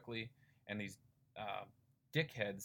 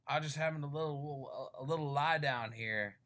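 put-on lightly slurred, higher drunk voice; drunk guy voice; 4 semitones higher, 4 dB louder, slower than usual. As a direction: play a cheerful drunk lying on the ground. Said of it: "this guy's like".

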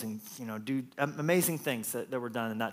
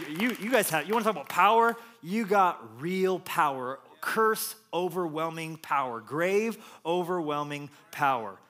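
proclaiming power; 4 semitones higher than usual. Acted. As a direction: preach it with building force. Jesus proclaiming real power.

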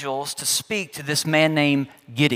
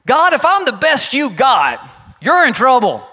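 higher and louder, half-shouted warning to God; exasperated Gideon; 6 semitones higher, 5 dB louder than usual. Retold or imitated. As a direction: throw the excuse up at God. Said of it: "That's what Gideon says".

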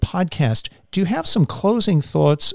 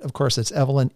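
ordinary talk

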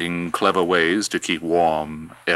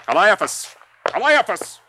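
shouting a name; 7 dB louder, slower than usual. Acted. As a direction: yell the name, rising high. Herod calling out for servant.

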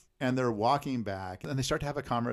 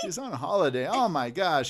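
loud, high mock astonishment; hammed-up exasperated amazement; incredulous retelling; 7 semitones higher, 8 dB louder than usual. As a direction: exclaim it in playful disbelief. Echoes the man's outraged correction.

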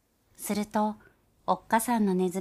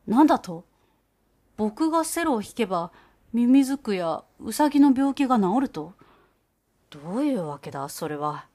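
surprised cellist; 5 dB louder than usual. Acted. Gauche startled by the mouse's claim, gruff surprise.

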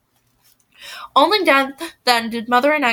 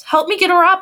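higher and louder order; commanding; 6 semitones higher, 5 dB louder than usual. A narrator's reading. Narrator orders Blythe to help lift Amelie, loud command. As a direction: bark it as a firm command. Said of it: "I commanded".

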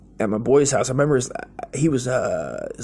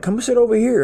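high coaxing come-hither, a bit louder; creepy uncle; 11 semitones higher, 5 dB louder than usual. Retold or imitated. Uncle luring the boy across the room, high coaxing voice. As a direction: lure the kid over sweetly. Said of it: "he was like".

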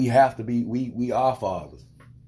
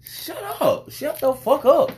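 high, raised fed-up snap; exasperated retort; 13 semitones higher, 4 dB louder, slower than usual. Retold or imitated. Imagining telling the yelling lady to shut up. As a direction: tell her off sharply.

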